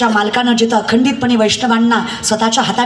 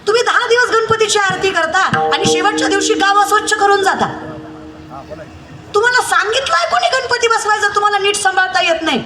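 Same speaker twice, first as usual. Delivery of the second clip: high-pitched, exhorting kirtan preacher; impassioned preaching; 8 semitones higher than usual. Acted. Preacher rebuking devotees over hollow Ganpati festival piety, high impassioned preaching. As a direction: keep the pitch up high and berate the congregation.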